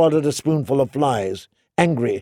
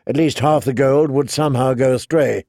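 usual speaking voice

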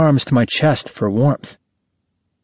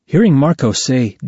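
ordinary delivery, nothing marked

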